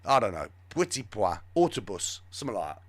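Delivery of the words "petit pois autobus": low, half-hearted mock French; badly faked French accent. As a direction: toss out bad fake French words. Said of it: "I can't do the French accent either".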